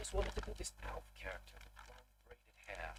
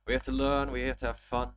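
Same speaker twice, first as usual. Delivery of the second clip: emphatic louder motto; 5 semitones higher, 11 dB louder than usual. Real, not acted. Motto repeated louder, more emphatic.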